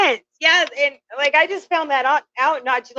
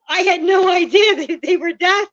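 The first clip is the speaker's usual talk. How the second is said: tearful regret; 7 semitones higher, 4 dB louder than usual. Real, not acted. Guilt over the deaf dog.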